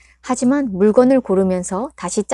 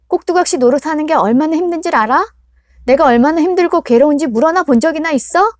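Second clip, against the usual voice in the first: loud, high accusing outburst, rushing at times; furious daughter; 6 semitones higher, 7 dB louder than usual. Acted. Daughter confronts her mother about the exam pressure, loud furious accusation. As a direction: pour out years of resentment.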